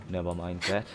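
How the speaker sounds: usual speaking voice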